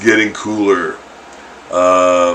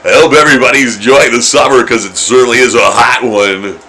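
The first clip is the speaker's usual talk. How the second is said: louder, upbeat radio announcer; 3 semitones higher, 6 dB louder than usual. Shown as a demonstration. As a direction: read it like a radio host. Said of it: "Sound like a radio guy".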